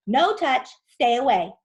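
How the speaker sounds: loud, sharp warning; warning yell. Acted. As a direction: warn them off loudly. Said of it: "yelled Tom".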